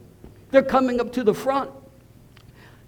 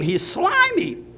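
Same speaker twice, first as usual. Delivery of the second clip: disgusted; 10 semitones higher, slower than usual. Real, not acted. Disgust at snotty convert.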